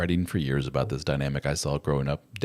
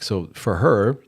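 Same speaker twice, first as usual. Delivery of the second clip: ordinary talk, nothing marked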